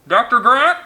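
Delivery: loud, high call through a megaphone; bullhorn call. A narrator's reading. Man on the sand calling for Alan through a bullhorn, amplified. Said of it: "asked through a bullhorn".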